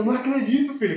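high, motherly disbelief. Retold or imitated. Pastor hears he passed, high motherly delight. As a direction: sound like a proud mother hearing news. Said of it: "Com aquela voz dela bem, tipo, de mãe mesmo".

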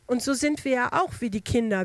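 ordinary delivery

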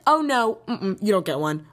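mocking clerk. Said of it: "And they were like".

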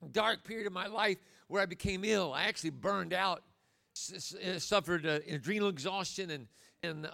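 ordinary talk

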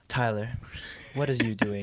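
low, soft mock-stern teacher. Teacher quietly confronting Tyler at his desk, low and stern. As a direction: drop down for a quiet teacher challenge.

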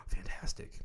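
gasp, then whisper in mock awe; breathless whispered delight, played up; hushed, hammed-up amazement; awed whisper. Delighted retold reaction to club's answer.

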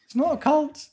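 high, slow, strange cult devotee voice; a creepy cult believer with his own calendar; cult member. Pretend. Host playing a cult member to Rob, high eerie voice.

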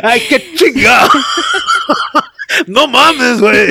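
loud, high laughing yell; laughing shout. Real, not acted. Laughing exclamation.

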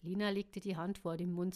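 ordinary delivery, nothing marked

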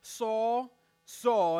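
a voice from heaven calling out. Retold or imitated. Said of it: "He heard a voice saying".

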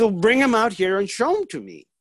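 imitating mom, high-pitched instruction; directing mother. Mother orders her son to show the items, directing. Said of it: "She said".

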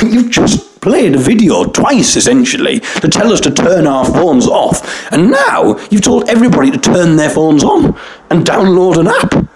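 high, exasperated disbelief; incredulous indignation. Retold or imitated. A passenger fuming at the crew's contradictory phone rules, high and exasperated. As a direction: pitch it up in outraged disbelief.

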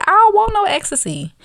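loud, very high retold protest; exasperated refusal. Woman turning round on a pushy boyfriend, exasperated refusal. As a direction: jump up high and loud to shut it down.

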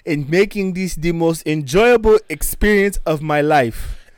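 put-on high, loud African-accent gushing; hammed-up African accent; mock African accent. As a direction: gush thanks in an exaggerated African accent. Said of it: "by our terrible accents".

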